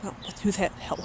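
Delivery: hushed, half-swallowed aside under the breath; startled aside. Real, not acted. Muttering to herself when someone walks in. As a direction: drop the voice to a muttered aside off to the side.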